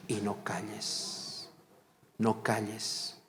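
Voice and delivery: slow, soft repetition of the title; slow repeat. Shown as a demonstration. Preacher repeating the sermon title to the church, slow and soft. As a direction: lay each word down slowly and quietly. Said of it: "Vuelvo a repetir".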